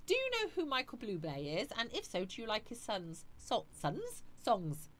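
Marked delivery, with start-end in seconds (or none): none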